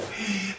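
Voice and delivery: 높고 짧은 놀란 숨 들이쉼; 무서워 숨을 확 들이켜. Pretend. (놀라서 헉 들이쉬는 소리)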